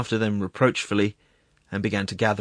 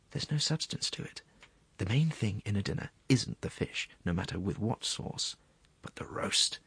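soft half-whispered food talk; hushed, savouring secretary; 8 dB quieter than usual. Acted. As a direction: dangle the meal temptingly under your breath. Secretary describes dinner in hushed voice.

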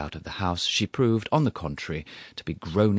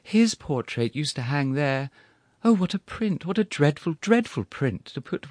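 tearful widow; 7 semitones higher than usual. Acted.

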